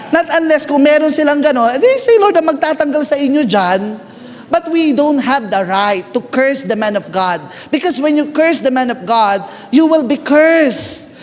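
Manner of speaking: rising, fervent preaching to the congregation. Acted. Pastor warns the church against cursing leaders, preaching fervently. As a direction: build the warning up like a sermon climax.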